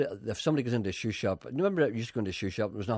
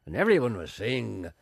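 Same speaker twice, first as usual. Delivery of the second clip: usual speaking voice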